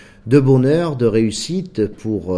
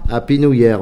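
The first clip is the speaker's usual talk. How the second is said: Happy New Year in a Marseille French accent, loud; Marseille-accented English; 4 semitones higher, 8 dB louder than usual. Real, not acted. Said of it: "mon accent marseillais me rend ridicule".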